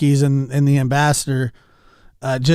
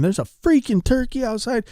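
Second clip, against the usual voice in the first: mock scream; 10 semitones higher than usual. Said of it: "I was screaming".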